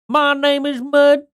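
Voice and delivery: high, slow, nasal-free cartoonish drawl. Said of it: "[name]'s video".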